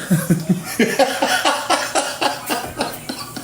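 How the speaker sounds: airy giggle up high; high breathless laugh. Real, not acted. Laughing at own impression.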